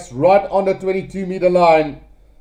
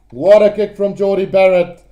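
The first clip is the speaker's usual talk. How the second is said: usual speaking voice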